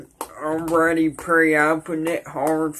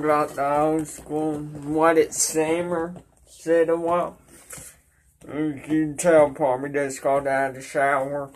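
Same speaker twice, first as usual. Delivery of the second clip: talking around tobacco pouch; with dip in lip; faster than usual. Real, not acted. Seen 0: Lower lip bulges outward throughout; snuff tin handled nearby.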